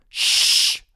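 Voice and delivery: sharp, forceful shh; loud "shh" for secrecy; loud shushing. A narrator's reading. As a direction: hush someone urgently. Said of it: "Freak goes".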